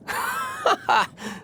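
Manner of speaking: a high, ringing burst of laughter; hearty gloating laugh. Acted. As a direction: laugh out at the thought of their faces. Gloating over the Harbingers' faces.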